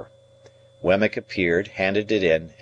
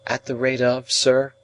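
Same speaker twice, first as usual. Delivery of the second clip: higher-pitched, questioning echo; puzzled young ward; 6 semitones higher than usual. A narrator's reading. Ward asks his guardian what he means, puzzled and deferential. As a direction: repeat it as a puzzled, respectful question.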